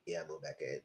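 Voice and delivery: deep soft lazy drone; mock husband. Vlogger mocking the husband again, in a low bored drone. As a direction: mumble it low like a bored liar.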